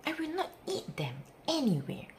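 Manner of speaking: ordinary delivery, nothing marked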